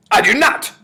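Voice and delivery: high, explosive witch's shout; furious, very loud outburst. A narrator's reading. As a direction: shout an outraged denial. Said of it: "shouted the Grand High Witch".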